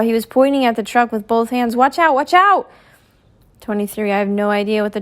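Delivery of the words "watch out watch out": warning shout. A narrator's reading. Pointing at the truck.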